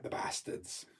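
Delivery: under the breath, voiceless; muttered aside. Real, not acted. Grumbling to viewers about a restaurant pulling out, muttered under the breath. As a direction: drop it to a soft aside.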